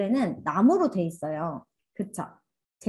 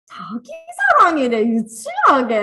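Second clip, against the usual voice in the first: put-on loud sneering "how childish", much louder; scornful mockery; 7 semitones higher, 8 dB louder than usual. Teacher mimicking a reader sneering at the title: loud scornful scoff. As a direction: scoff it out loud like tossing the book aside. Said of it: "이러면서".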